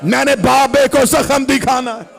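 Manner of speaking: sustained mournful chant easing at the end; impassioned lament preaching. Acted. Zakir voicing Zahra's plea to Ali, chanted lament. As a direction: plead in a chanted cry.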